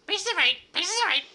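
high, loud squawking parrot cry; pirate parrot voice. Pretend. Pirate's parrot squawking its captain's name, high screech. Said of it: "or even".

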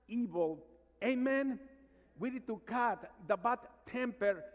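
rising, forceful preaching with call and response. Acted. Priest urging his congregation to cut sin, preaching.

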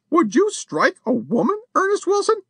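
high, indignant outcry rising at the end; appalled, shocked protest; the lady crying out at the burglar, high and appalled. A narrator's reading. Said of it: "cried Missus Champney".

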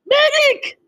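high urgent call for a medic; man down shout. Real, not acted. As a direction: yell for help, desperate. Calling for healing in game.

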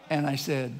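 ordinary talk

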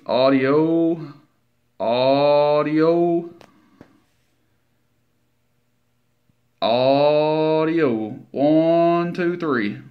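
drawn-out audio count into a CB mic; radio mic check. Real, not acted. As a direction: call the count steadily into the keyed mic. Keying mic at average power.

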